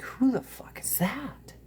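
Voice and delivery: a horrified whisper to oneself; whispered shock. Retold or imitated. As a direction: whisper it in disbelief at the mirror. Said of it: "I would be like".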